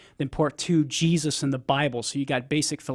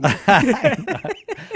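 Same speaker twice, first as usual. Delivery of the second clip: amused laugh; 9 semitones higher, 6 dB louder than usual. Real, not acted. Cohost laughs at teasing.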